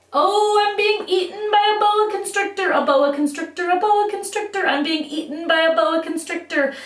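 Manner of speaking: high, theatrical, playful panic; panicking snake victim. Acted. Victim being eaten by a boa constrictor, hammed-up alarm. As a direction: wail comically as you are swallowed bit by bit.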